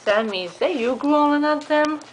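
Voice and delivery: loud call. Real, not acted. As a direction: call out sharply. Scolding a growling pet.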